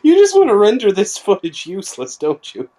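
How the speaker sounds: high, laughing mock complaint; laughing accusation. Real, not acted. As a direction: accuse him through a laugh.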